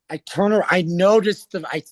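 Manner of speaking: ordinary talk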